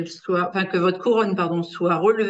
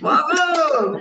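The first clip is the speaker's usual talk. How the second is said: excited praise; 6 semitones higher, 4 dB louder than usual. Real, not acted. Teacher praises a correct answer.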